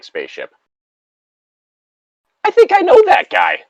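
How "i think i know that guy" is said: very high, tiny squeak of a voice; a high silly squeak claiming to know the guy; squeaky joke voice. Pretend. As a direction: squeak it out like a little cartoon critter. Joking about a game creature.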